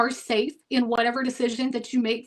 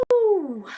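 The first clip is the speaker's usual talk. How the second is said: emotional whoop; 6 semitones higher, 3 dB louder than usual. Real, not acted. Overwhelmed after powerful message.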